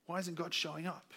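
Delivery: soft, hushed tempting voice; devil's whisper. Retold or imitated. The devil whispers in our ear to tempt, soft and hushed. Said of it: "he says".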